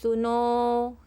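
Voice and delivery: सुनो called loud, final vowel held long; calling out. Shown as a demonstration. Teacher demonstrating a long vowel in a call. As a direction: call someone far off. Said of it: "कितना लंबा स्वर लगाना पड़ा".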